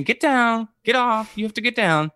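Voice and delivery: high, firm dog command; scolding a dog. Retold or imitated. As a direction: tell the dog to get down. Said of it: "me saying".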